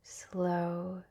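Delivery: ordinary delivery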